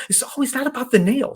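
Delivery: high, put-upon woman insisting it's not the nail; whiny woman voice. Retold or imitated. Coach retelling a comedy video, high exasperated wife voice. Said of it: "she goes".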